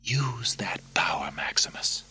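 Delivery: conspiratorial whisper; urgent whisper. Acted. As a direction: hiss the plea in secret. Secret plotting in prison.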